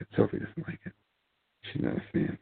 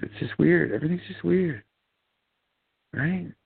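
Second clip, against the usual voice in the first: exasperated; 10 semitones higher than usual. Real, not acted. Exasperated repeat of weird.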